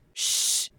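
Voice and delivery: soft, voiceless "Shh"; hushing shh. Shown as a demonstration. Teacher shows how to ask for quiet, hushing. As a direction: hush someone softly. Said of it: "sound you use if you want someone".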